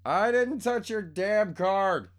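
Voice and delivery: loud, high, slow yell; yelling back. Real, not acted. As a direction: bellow it across the house.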